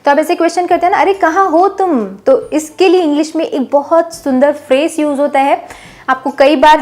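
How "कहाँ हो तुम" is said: ordinary talk, nothing marked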